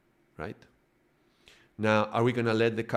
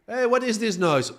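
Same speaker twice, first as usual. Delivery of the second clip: much higher and louder, suddenly alarmed; startled captain; 12 semitones higher, 5 dB louder than usual. Retold or imitated. Soul hears noise on deck, high and startled. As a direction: pop out of the cabin demanding answers. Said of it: "he's like".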